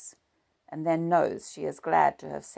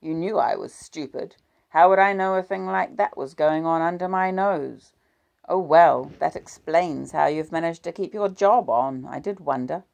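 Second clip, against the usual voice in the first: 1 bitter sarcasm; 3 semitones higher, 10 dB louder than usual. A narrator's reading.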